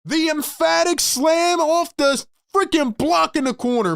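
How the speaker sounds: thrilled commentator riding the peak; ecstatic play-by-play. Real, not acted. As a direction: celebrate the highlight at a high pitch. Celebrating the dunk live.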